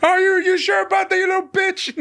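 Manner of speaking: high, fast, shouted taunt; mock taunt. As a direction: sneer it at him across the parking lot. Voicing Cole back at Kyle.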